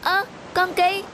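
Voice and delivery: surprised slow discovery. Acted. Finds lost dog on boat.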